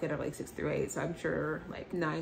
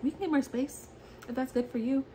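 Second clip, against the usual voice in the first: higher, lighter mock Brett voice; imitating Brett; 7 semitones higher than usual. Brett quoted, voice put on.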